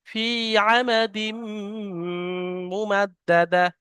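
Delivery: slow melodic tajweed recitation; Quran recitation. Shown as a demonstration. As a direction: chant it slowly with tajweed. Teacher recites the surah.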